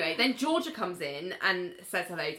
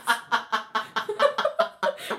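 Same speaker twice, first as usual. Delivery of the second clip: laughter; 4 semitones higher, 4 dB louder than usual. Real, not acted. Laughs at slip.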